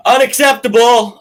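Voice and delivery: loud, high outburst of disapproval; indignant shout. Real, not acted. Outburst over bad second half.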